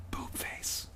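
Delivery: breathless unvoiced whisper. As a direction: whisper it, giggling. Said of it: "you whisper".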